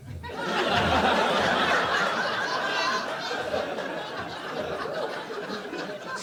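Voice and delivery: a long breathy cackle falling away; real laughter at the punchline; long cackling laugh. Real, not acted. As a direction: laugh it out at your own joke. Long laugh at own joke.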